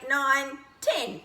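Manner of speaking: ordinary delivery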